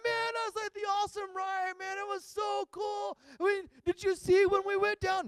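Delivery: put-on high, gushing teen excitement; excited. Kids raving to dad about the awesome ride. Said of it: "We were like".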